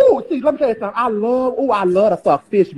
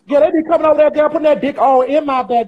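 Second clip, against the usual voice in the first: ordinary delivery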